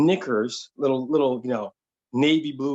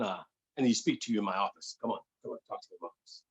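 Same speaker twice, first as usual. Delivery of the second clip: softer, fast, whispering by the end; hushed manager; 4 semitones lower, 8 dB quieter, much faster than usual. Retold or imitated. Manager quietly calling the waiter into his office, whispering. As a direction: pull him aside in a low whisper.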